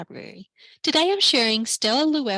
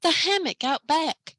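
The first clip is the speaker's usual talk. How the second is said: higher, breathless sudden realisation; gasped; 6 semitones higher than usual. Acted. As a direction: gasp it out as it dawns on her. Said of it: "she gasped".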